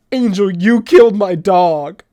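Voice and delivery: loud, slow, wounded accusation; retold accusing shout. Girlfriend blaming him for her dog's death, loud slow shout. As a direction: shout the blame at him, drawn out.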